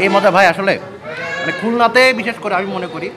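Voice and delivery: ordinary talk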